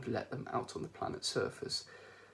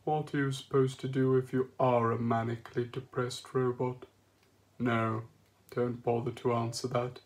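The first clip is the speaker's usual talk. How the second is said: gloomy robot; 3 dB louder than usual. Acted. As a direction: talk like you're addressing a coffin. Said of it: "as if he was addressing a newly occupied coffin".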